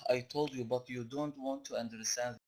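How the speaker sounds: ordinary talk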